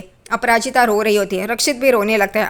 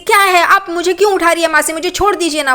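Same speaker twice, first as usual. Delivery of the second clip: higher, louder cranky whine; annoyed girl; 6 semitones higher, 4 dB louder than usual. Retold or imitated. Girl woken by her aunt, cranky protest. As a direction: push the aunt away, irritated.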